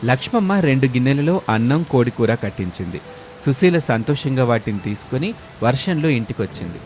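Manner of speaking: ordinary delivery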